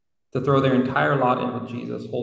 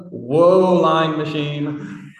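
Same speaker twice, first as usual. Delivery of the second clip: mock outrage; 3 semitones higher, 5 dB louder, much slower than usual. Real, not acted. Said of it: "reacting to chatbot answers".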